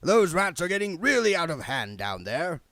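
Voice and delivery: loud, staged remark; said loudly. A narrator's reading. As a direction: say it loud so the bogey hears. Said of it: "he said loudly".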